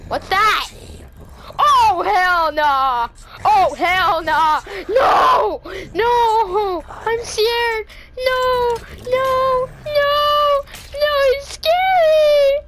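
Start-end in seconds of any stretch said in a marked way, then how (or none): 1.6-3.1 drawn out slowly in rising dread. Real, not acted. Gamer seeing the monster coming, slow loud dread. Sees the threat approach. 3.4-4.6 crying out in panic, loud; panicked cry. Real, not acted. Gamer panicking as the monster rushes in, crying out. 4.9-12.6 loud high screams of real terror; screaming in fear. Real, not acted. Player screaming at the monster in terror.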